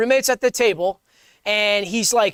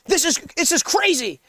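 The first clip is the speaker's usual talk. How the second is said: amazed roommate; 5 semitones higher than usual. Acted. Roommate showing off ketchup to his friend, amazed. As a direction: marvel at it in a higher voice.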